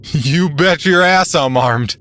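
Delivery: high, loud, drawn-out crazed boast; cornered suspect, defiant. A narrator's reading. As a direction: stretch out the threat, loud and unhinged. Cornered suspect threatens the police.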